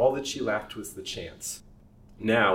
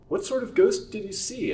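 much higher urgent question; eager question; 12 semitones higher, 5 dB louder than usual. Acted. Darling presses the servant about the ghost, urgent. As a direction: press him for details.

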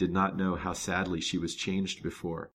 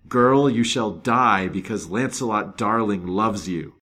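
slow, much louder, venomous threat; murderous cry; 3 semitones higher, 11 dB louder, slower than usual. Retold or imitated. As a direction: hurl the curse at her rival. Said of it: "and exclaimed".

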